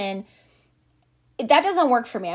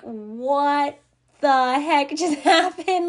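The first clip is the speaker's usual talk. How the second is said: higher and louder, laughing through it, slow; baffled laugh; 5 semitones higher, 4 dB louder, much slower than usual. Retold or imitated. Narrator baffled by the server's switch, laughing in disbelief.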